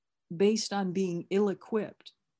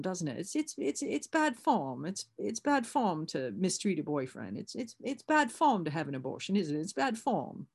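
Beryl; 2 semitones higher than usual. Retold or imitated. English sponsor explaining "inconsiderate" to her sponsee, in a mock English accent. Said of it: "I'm doing a dreadful English accent".

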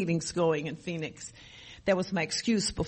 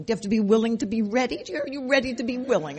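normal talking voice

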